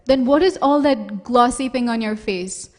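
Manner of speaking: loud, confronting question; accusing mother. Acted. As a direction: confront the child loudly. Mother confronts her son.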